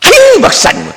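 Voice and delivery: high, coy "아유" from a churchwoman; teasing deaconess. Retold or imitated. Deaconess teasing the pastor as she leaves, high and coy. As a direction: squeal it up high, playfully. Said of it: "그러면서 뒤돌아가는데".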